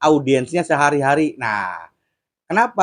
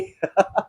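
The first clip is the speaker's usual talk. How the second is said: short laugh; 3 semitones higher, 5 dB quieter than usual. Real, not acted. Laughing at own joke.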